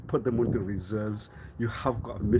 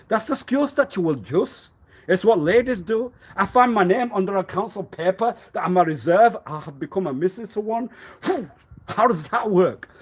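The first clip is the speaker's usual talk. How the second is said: mock outrage; 7 semitones higher, 5 dB louder than usual. Mocking the ladies' excuse.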